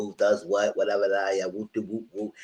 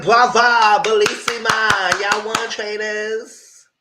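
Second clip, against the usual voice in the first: high, loud, slow theatrical bravo; mock Italian praise; 10 semitones higher, 7 dB louder, much slower than usual. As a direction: gush like an opera fan applauding. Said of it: "I'm gonna say".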